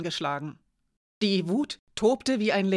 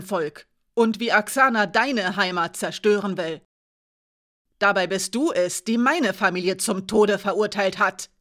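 loud, bitter accusation; angry accusation; 5 dB louder than usual. Acted. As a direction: hurl the blame at her. Alina accuses the traitor. (die Verräterin laut und bitter anklagen)

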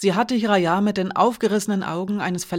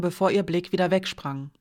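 usual speaking voice

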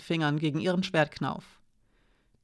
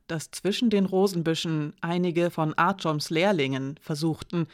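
ordinary delivery

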